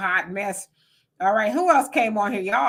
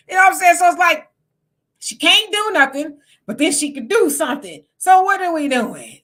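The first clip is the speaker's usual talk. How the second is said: louder, higher, fed-up; raised exasperated voice; exasperated outburst; 6 semitones higher, 5 dB louder than usual. Real, not acted. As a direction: throw up your hands at the contradiction. Exasperated rant at contradiction.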